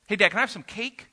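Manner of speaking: put-on quick, eager little-boy voice; lightly voiced five-year-old begging for cake; child asking. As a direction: son asking dad for cake, a hint of a kid's voice. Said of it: "he says".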